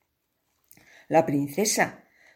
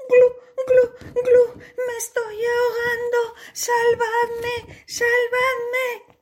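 much higher, fast panicked cries for help; drowning princess cries. Acted. Princess in the crocodile moat cries for help. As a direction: gasp and plead for rescue.

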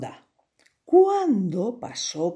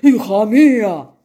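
louder, gruff scolding king; stern king; 3 semitones higher, 10 dB louder than usual. Acted. King scolds his daughter, loud and stern. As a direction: scold your silly daughter. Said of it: "dijo el rey".

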